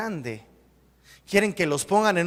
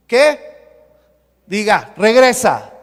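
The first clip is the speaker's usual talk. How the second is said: calling out; 2 semitones higher, 12 dB louder than usual. Real, not acted. Preacher telling the congregation to say "regresa", loud. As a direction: call it out so they repeat it. Rousing congregation to repeat the word.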